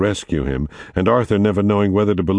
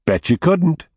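taunting; 11 semitones higher than usual. Acted. Jake taunting his brother.

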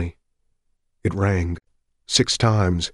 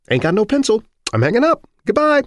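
high, louder brush-off; dismissive stranger; 6 dB louder than usual. Acted. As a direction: toss him off and hang up.

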